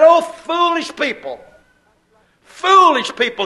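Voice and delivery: Paul scolding the Galatians; exasperated cry. Acted. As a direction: cry out at the foolish crowd.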